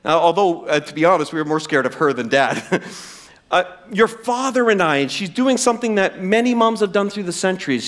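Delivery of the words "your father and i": amping it up. Retold or imitated. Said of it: "She's amping it up".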